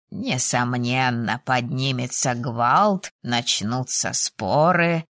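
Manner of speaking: put-on low, very slow elderly professor; old dean. A narrator's reading. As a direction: draw each phrase out in an old man's low voice. Old dean agreeing with students. (низко и неспешно, голосом старого декана)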